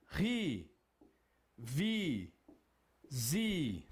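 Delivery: RI, VI, ZI, each ending in a long "ee"; syllable pronunciation. Shown as a demonstration. Teacher demonstrating consonants joined with I, separate drawn-out syllables. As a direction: draw out "Ri", "Vi", "Zi" one at a time. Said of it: "Aqui como fica a pronúncia?".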